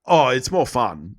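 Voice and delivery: ordinary talk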